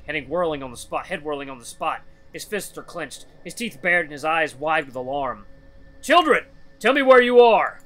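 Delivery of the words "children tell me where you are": high, very loud bellow. Acted. Loken searching for children in the darkness, desperate bellow. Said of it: "He bellows out to the darkness".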